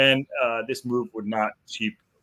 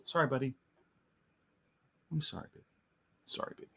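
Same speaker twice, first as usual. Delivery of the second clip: much softer, tender apology; soft to the dog; 2 semitones higher, 12 dB quieter, faster than usual. Real, not acted. Host apologising softly to his dog during the stream. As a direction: murmur it gently to a pet.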